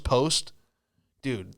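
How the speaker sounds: usual speaking voice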